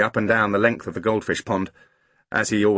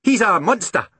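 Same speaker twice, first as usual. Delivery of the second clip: 小男孩高声喊，音调高; 骄傲地冲父亲大声宣布; 喊着说; 10 semitones higher, 4 dB louder than usual. A narrator's reading. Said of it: "shouted Angus".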